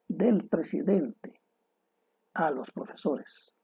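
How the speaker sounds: del presidente said slowly, with pauses between the phrases; slow it down and pause so the meaning is clear; pausa marcada. Shown as a demonstration. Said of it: "lo que estamos hablando es". (despacio, con pausa entre del presidente y a los profesores)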